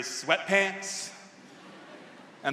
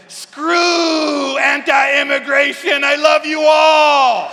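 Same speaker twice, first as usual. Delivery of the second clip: ecstatic shout; 12 semitones higher, 12 dB louder, much slower than usual. Retold or imitated. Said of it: "and I said".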